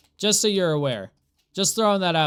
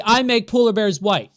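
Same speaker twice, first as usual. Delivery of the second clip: louder, scornful reading; mocking disbelief; 5 semitones higher, 7 dB louder than usual. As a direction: read it out with scorn.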